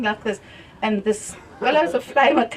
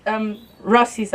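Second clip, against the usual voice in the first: normal talking voice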